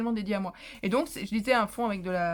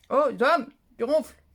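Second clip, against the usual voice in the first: plus fort, pour le réveiller; appel au mari; 2 semitones higher, 9 dB louder than usual. Real, not acted. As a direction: lancer au mari qui ronfle. Interpelle son mari qui ronfle. (au mari qui ronfle, voix forte)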